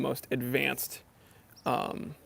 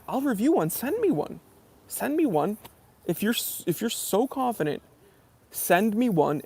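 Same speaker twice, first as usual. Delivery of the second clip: higher, insistent challenge; challenging; 6 semitones higher than usual. Real, not acted. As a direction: dare them, pitch up. Challenging Invicta fans.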